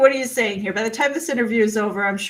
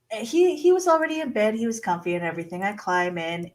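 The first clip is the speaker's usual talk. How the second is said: plain reading voice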